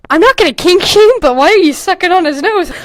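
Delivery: loud, high disbelief; incredulous. Real, not acted. Reading her old story, baffled and loud. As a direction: call out the weird line in disbelief.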